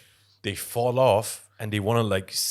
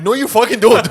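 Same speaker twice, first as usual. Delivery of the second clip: much higher, louder laughing shout of disbelief; incredulous laughing yell; 20 dB louder than usual. Retold or imitated. Mocking Tyson's tiger claim with a laughing high yell. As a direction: shriek it through laughter like it's absurd.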